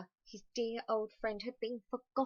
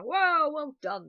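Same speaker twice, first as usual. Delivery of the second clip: bin men cheering; 7 semitones higher, 11 dB louder, slower than usual. A narrator's reading. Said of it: "they said".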